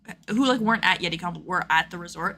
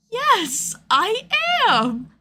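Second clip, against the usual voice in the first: high, louder, drawn-out answer; mock delighted reply; 13 semitones higher, 5 dB louder, much slower than usual. Cosplayer answering a fan who recognized her, high hammed-up reply. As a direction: play up a high, proud confirmation.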